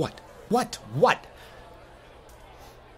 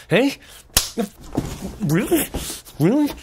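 mocking challenge; 10 semitones higher than usual. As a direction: taunt him in disbelief.

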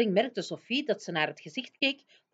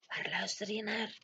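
voiceless, much softer whisper; 2 semitones higher, 8 dB quieter than usual. Acted. Sophie whispering to the giant at the palace window. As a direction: breathe the question, don't wake anyone. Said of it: "fluisterde Sophie terug".

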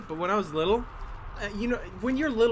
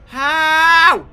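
loud, high disbelieving shout; shocked shout; 10 semitones higher, 14 dB louder than usual. Real, not acted. Player stunned by what just happened, shouting. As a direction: blurt it out in total disbelief.

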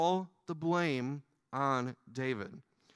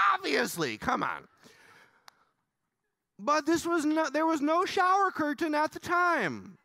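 higher, louder playful build-up; excited joking; 9 semitones higher, 4 dB louder than usual. Real, not acted. Joking to the church about the shower curtain, higher and louder. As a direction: ham up the joke for the room.

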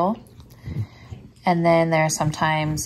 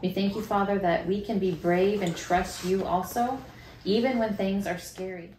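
put-on reading to children; 4 dB quieter than usual. Reads Bible story, prays with child.

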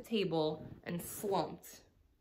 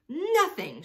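much higher and louder holler; 10 semitones higher, 9 dB louder than usual. A narrator's reading. Trixie the rat hollers after searching the bed. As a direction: yell it across the room. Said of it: "she hollered".

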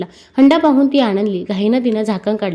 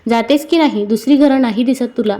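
plain reading voice

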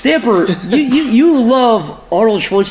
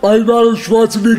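mock Arnold intro. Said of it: "they always do the".

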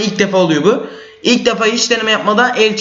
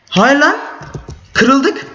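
high dismayed no; sudden high dismay; shocked cry; 9 semitones higher, much slower than usual. Real, not acted. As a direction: cry out in disbelief. Bed broken in game.

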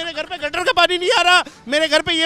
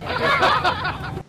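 scornful laugh; 5 semitones higher than usual. Real, not acted. Laughs at the government claim.

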